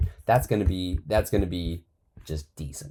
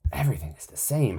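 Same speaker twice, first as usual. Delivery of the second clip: whisper; 3 semitones higher than usual. Real, not acted. Complaining that all text looks same.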